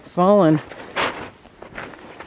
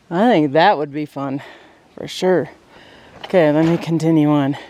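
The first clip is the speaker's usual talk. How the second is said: usual speaking voice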